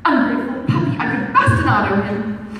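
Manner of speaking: loud indignant sailor growl; angry outburst. A narrator's reading. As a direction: spit it out in contempt. Captain's outburst in story.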